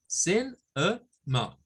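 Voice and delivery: sin, e, ma split into three syllables. Shown as a demonstration. Teacher spelling out the syllables for students. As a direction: break sin, e, ma apart. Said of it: "son tres sílabas".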